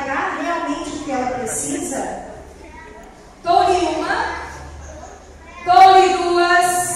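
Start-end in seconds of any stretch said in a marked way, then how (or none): 3.4-6.9 loud auctioneer's call. Acted. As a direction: call the bid out to the room. Auction of a soul, calling bids. (pregão de leiloeiro)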